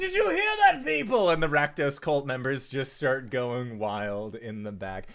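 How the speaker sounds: high, loud shout to the crowd; excited demon lord. Acted. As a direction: whip the cult into a frenzy. Rakdos hypes the crowd.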